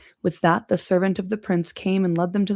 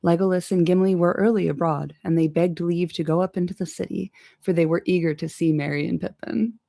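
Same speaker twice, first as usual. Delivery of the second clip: ordinary delivery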